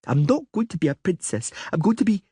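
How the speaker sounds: put-on high girlish voice, stubborn and petulant; a spoiled little princess making her case; young girl Ciri. A little princess arguing with the Witcher, high girlish voice.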